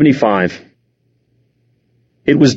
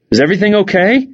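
higher, tentative rising question; anxious question; 8 semitones higher, much faster than usual. A narrator's reading. Mom checking on father and son, anxious. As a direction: ask it worriedly, pitched up. Afraid mom creeps downstairs, asks.

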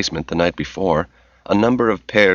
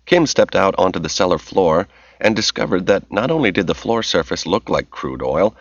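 plain reading voice

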